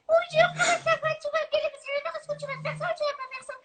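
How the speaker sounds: high squeaky cartoon voice; squeaky, giggly little cartoon character. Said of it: "une petite voix de dessin animé".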